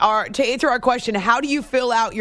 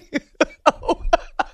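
breathy laugh; 6 semitones higher than usual. Real, not acted. Host laughs at forgetting movie title.

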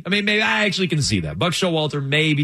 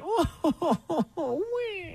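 mock sob; 12 semitones higher, 5 dB quieter than usual.

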